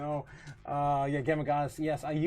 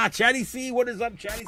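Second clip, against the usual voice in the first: cheery greeting; 9 semitones higher, 7 dB louder than usual. Real, not acted. Greeting a chatter.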